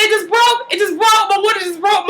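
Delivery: high-pitched, urgent acted cry; frantic announcement. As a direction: blurt it out in mock alarm. Acted announcement that water broke.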